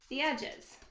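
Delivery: ordinary talk, nothing marked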